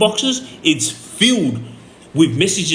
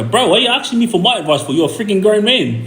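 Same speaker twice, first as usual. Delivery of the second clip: higher, fast exasperated challenge; 5 semitones higher, faster than usual. Retold or imitated. Retelling his challenge to a friend who wants advice, exasperated. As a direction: push back in disbelief at a grown man.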